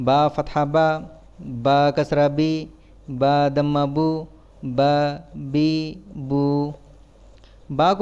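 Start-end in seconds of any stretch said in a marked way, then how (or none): 0.1-6.4 even, monotone syllable-by-syllable drill; measured classroom letter drill; spelling drill recitation. Shown as a demonstration. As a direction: recite it like a spelling chant, pausing between items.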